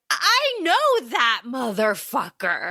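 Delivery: slow, then a high squeak on I know; imitating Lucy, drawn out and cartoonish; mock Lucy voice. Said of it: "And Lucy's like".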